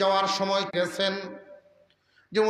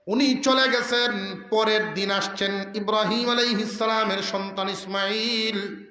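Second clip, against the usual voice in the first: normal talking voice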